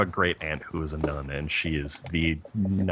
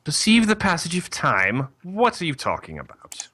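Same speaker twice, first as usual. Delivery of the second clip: incredulous mother superior, pitched higher; nun voice; 9 semitones higher than usual. Acted. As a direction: challenge it like a baffled, stern nun. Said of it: "when I thought nun voice".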